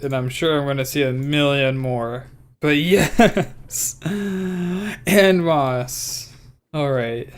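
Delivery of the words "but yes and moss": high, happy cheering with a laugh; excited. Real, not acted. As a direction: cheer with a giggle. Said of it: "way too excited".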